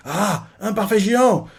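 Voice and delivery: de plus en plus haut, jusqu'à un cri lent; s'emballer devant chaque dessert; extase gourmande. Retold or imitated. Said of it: "elle fait". (Bonnie s'extasie, de plus en plus aiguë)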